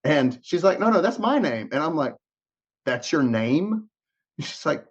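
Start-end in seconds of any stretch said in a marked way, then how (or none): 0.8-1.7 higher-pitched imitated protest; insistent correction. Acting out agent's correction. 2.8-3.9 slow, incredulous echo; disbelieving question. Retold or imitated. Acting out his disbelieving question.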